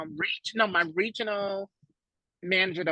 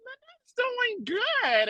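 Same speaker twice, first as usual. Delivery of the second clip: high, slow; high drawn-out answer to the aunt; slow reply; much slower than usual. Retold or imitated. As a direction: say it high and slowly.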